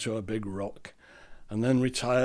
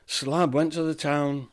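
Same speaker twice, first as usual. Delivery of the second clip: usual speaking voice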